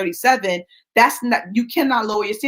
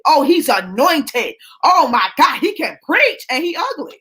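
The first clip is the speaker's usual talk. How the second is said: mock gushing with higher, swooping emphasis; gushing admirer; 4 semitones higher than usual. Mimicking people who marry the anointed, gushing. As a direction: imitate a swooning churchgoer, voice climbing.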